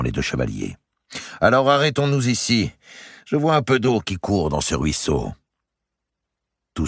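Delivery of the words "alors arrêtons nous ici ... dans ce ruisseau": a hint of a higher, commanding jailer; lightly voiced knight. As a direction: give the halt order lightly in character. Narrator voices Maltravers.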